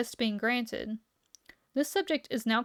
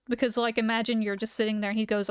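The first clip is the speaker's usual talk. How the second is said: usual speaking voice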